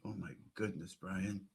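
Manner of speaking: exasperated mutter. Real, not acted. Streamer worn out by Brian's clip, exasperated and soft. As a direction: sigh it out under your breath.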